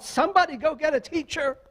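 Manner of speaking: high, urgent retold yell. Sister calls to a crowd at the fight, retold yell. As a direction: call out fast for help, imitating a panicked teacher. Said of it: "she yelled out".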